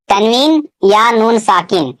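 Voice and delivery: ordinary talk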